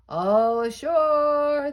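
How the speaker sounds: high, loud, slow call from the deck; sailors shouting. Acted. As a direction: bellow it out like sailors landing. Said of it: "the men shouted".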